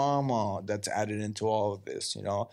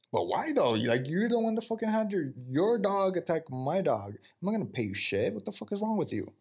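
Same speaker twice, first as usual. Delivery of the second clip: pitched-up mock outrage; indignant retold protest; 6 semitones higher than usual. Dog owner refusing to pay, mock outraged protest. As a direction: play the wronged owner, voice jumping higher.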